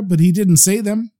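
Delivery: ordinary delivery, nothing marked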